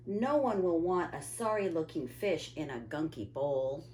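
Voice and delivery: plain reading voice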